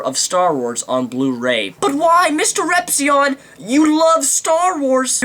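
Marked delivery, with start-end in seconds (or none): 1.8-5.2 mock fan question. Imagined viewer asks him.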